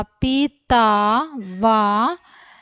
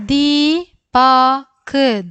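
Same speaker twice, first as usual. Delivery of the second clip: Deepak drawn out slowly and louder; slow louder read; 3 semitones higher, 5 dB louder, much slower than usual. Shown as a demonstration. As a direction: model Deepak slowly for the class. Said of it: "അക്ഷരങ്ങൾ ഓരോന്നോരോന്നായിട്ട് വായിക്കാം".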